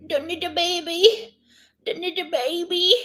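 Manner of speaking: high cooing pet voice; baby talk. Pretend. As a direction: coo sing-song up high to the baby. Baby-talking to her pet.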